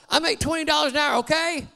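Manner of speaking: father hollers at his son; hollering. Retold or imitated. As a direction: bark the number at the child. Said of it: "And he hollers".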